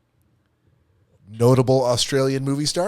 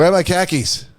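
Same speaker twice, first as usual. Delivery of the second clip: Boston accent, car keys said as khakis; pitched-up Boston accent, loud; 9 semitones higher, 7 dB louder than usual. Shown as a demonstration. Showing how a Bostonite asks for car keys, Boston accent. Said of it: "speak like someone from Boston".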